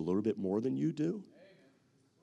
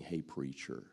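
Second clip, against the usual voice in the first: much lower and softer, gently reassuring; low consoling men; 11 semitones lower, 10 dB quieter than usual. Retold or imitated. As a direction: soothe him like a worried friend. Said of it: "And they're saying".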